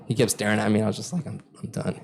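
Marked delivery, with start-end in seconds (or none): 1.2-2.0 very soft, low mumble of retreat; bored and tired. Retold or imitated. Player giving up on the staring man, tired and quiet. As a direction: let the energy drain out, low and quiet. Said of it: "I got kinda bored and tired".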